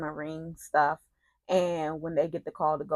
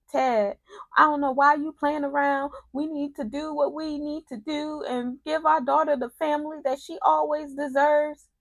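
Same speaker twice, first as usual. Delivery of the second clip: high-pitched mock plea; hammed-up imitation of a desperate ex; imitated pleading; 9 semitones higher than usual. Acts out Leslie's plea to Ted.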